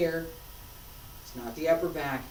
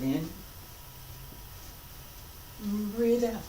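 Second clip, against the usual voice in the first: guided breathing cues; 3 semitones lower, 9 dB quieter than usual. Acted. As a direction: lead the class through each breath.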